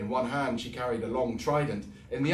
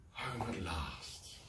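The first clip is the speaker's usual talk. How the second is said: sighed; 10 dB quieter, much slower than usual. Acted. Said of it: "he sighed".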